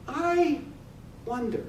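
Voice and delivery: soft, drawn-out musing; hushed wonder. Acted. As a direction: let the idea dawn quietly. Eyeing tablecloth over the hole.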